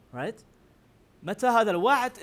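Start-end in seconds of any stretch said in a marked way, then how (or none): 1.2-2.2 sarcastic taunt. Retold or imitated. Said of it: "is a sarcastic way of saying".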